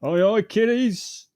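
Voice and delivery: loud, high, drawn-out Cockney holler; stretched mock Cockney shout; Cockney accent. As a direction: call the children in hammed-up Cockney. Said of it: "in his fucking Cockney accent".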